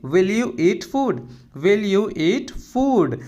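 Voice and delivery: slow, carefully spelled-out English phrase; slow and clear classroom model phrase; slow teaching demo. Shown as a demonstration. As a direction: say each word slowly for the learner. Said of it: "क्या तुम खाना खाओगे?".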